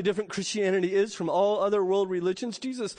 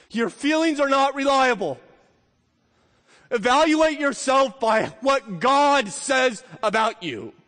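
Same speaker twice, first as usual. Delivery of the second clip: preaching at full force; 4 semitones higher, 4 dB louder than usual. Acted. Climactic not-guilty declaration.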